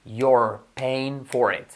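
very slow, every sound of "you're paying for it" spelled out; slow drill. Shown as a demonstration. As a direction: drag each word for the student to copy. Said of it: "Hacerla I como una E y reforzar la R". (despacio, sílaba a sílaba)

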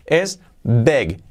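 big with the I said like a Spanish E, "beg"; close vowel big. Shown as a demonstration. Teacher showing students how big really sounds, "beg". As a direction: say big as "beg", short and tight. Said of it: "la I como una E".

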